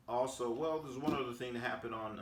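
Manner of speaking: normal talking voice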